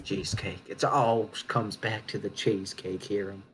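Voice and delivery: low conspiratorial whisper; hushed whisper. Acted. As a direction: confide it in a whisper.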